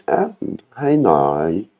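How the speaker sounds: ordinary talk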